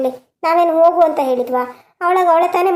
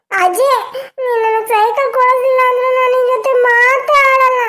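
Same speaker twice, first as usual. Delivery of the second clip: put-on much higher, whiny little boy threats; sulking child; 8 semitones higher than usual. Grandson threatening grandma to stop eating, sulky whine. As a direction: pout and threaten like a spoiled kid.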